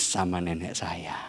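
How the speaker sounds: soft, low confiding whisper. Real, not acted. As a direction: let the punchline slip out in a whisper. Confiding the secret of harmony. (bisikan rahasia)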